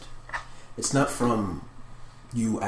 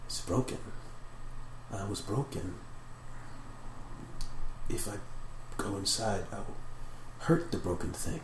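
hushed, halting inner voice; thinking to itself; 11 dB quieter than usual. Acted. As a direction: puzzle it out softly, pausing between thoughts. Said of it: "it thinks to itself".

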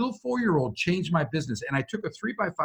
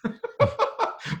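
very high breathy mock laugh; hammed-up cackle. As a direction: cackle it out playfully. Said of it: "But you didn't laugh like that".

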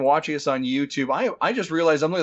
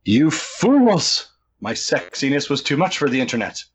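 mock villain boom, loud; booming echoing villain monologue; 4 dB louder than usual. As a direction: gloat like a cartoon villain. Mock villain after stream froze.

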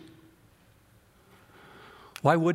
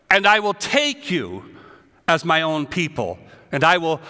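preaching at full force; 3 semitones higher, 4 dB louder than usual. Acted. Preacher proclaiming God's words to his congregation, loud. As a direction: declare each phrase like thunder.

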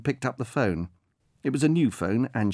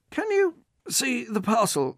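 higher, slower, over-patient voice; patient coaxing; 13 semitones higher, slower than usual. Acted. Delivery man urging the old woman outside, patient. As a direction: coax her gently outside.

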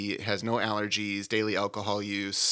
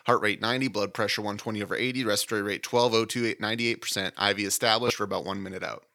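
normal talking voice

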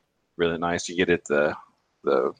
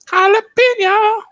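much higher, slow, louder sing-song; mock falsetto; 7 dB louder, much slower than usual. Host clowning about the sauce, in squeaky falsetto. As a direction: stretch it out in a silly high squeal. Playful reply over drinks.

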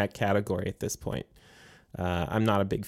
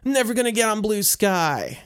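mocking whiny quote; 8 semitones higher than usual. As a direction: imitate stubborn people mockingly. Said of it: "There are folks out there saying".

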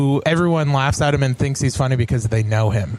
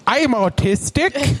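high, slow mock Australian accent; amused Australian-accent imitation; 8 semitones higher, slower than usual. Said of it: "that's the accent".

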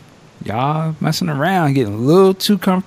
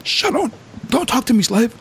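imitating rant; 10 semitones higher than usual. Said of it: "they're like".

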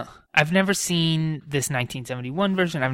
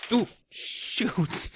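startled; 9 semitones higher, 3 dB quieter than usual. Real, not acted. Marble drops off board.

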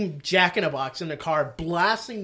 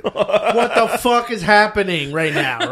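mock outrage; 5 semitones higher, 7 dB louder, slower than usual. Woken at 3 AM, outraged.

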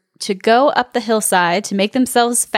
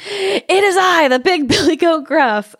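big goat's hoarse voice; 8 semitones higher than usual. A narrator's reading. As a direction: announce yourself as the big goat, in all caps. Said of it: "hoarse voice of his own".

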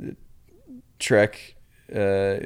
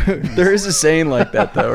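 laughing it out, higher and louder; 7 semitones higher, 6 dB louder than usual. Real, not acted. Guest laughing at his own invented saying. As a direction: say it through a laugh.

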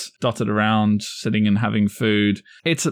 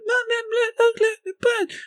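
put-on whiny kid voice.

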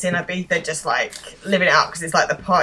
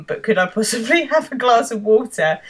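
higher, laughing mock plea; pleading; 4 semitones higher than usual. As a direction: ask politely, a hint of pitiful. Acted battered kidnap victim's plea.

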